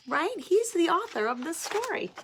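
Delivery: usual speaking voice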